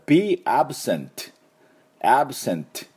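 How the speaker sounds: "be absent" said slowly, with a clear final t in "absent"; teacher demo of "absent" with a stopped t; model pronunciation. Shown as a demonstration. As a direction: finish "absent" with a sharp t. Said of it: "Tを使います".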